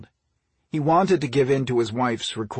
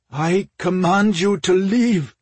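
loud, slow, higher-pitched command; raised voice of a desperate command; trembling order; 7 semitones higher, 6 dB louder, slower than usual. A narrator's reading. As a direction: order them out, drawn out and forceful. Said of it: "ordered in a trembling voice".

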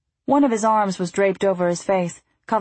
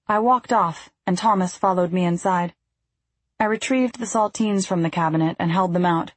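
plain reading voice

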